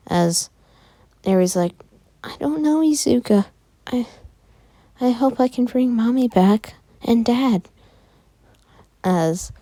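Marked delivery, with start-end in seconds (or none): 2.2-7.7 put-on high child voice with halting pauses; forlorn little girl. Eri hopes to bring her parents back, high and halting. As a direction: wish it in a small, raised child's pitch.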